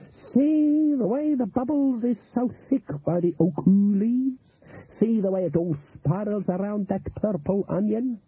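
high, slow voodoo-woman character voice. Acted. Voodoo woman showing the cook signs in the pot, intent. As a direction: draw it out high, pointing out each sign.

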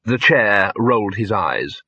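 plain reading voice

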